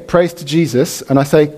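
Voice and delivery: usual speaking voice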